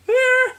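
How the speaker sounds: tiny falsetto eh; high squeak. Pretend. Squeak and grunt routine.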